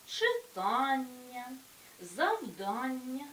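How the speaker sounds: читання, завдання with a long stressed АН; stress on suffix. Shown as a demonstration. Teacher demonstrating suffix stress, читання and завдання drawn out on АН. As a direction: slowly stretch the АН in читання, завдання. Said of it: "цей же наголошений суфікс".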